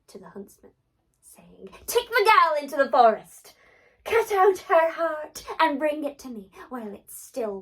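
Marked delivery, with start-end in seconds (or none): 1.9-7.6 loud, cold royal command; commanding queen. Acted. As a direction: order it like a ruthless queen. Queen orders the huntsman.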